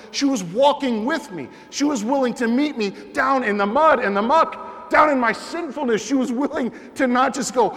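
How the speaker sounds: high, loud preacher build about her never giving up; loud, rising fervent testimony; preaching at full force. Acted. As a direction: preach it out, rising with each line.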